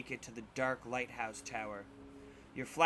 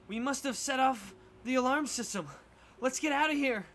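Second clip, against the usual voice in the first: put-on worried kid voice; 10 semitones higher, 4 dB louder than usual. Kids realize alarm set off.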